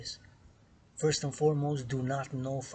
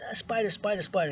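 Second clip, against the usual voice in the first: panicked screaming; 8 semitones higher, 5 dB louder than usual. Retold or imitated. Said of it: "starts screaming in my room".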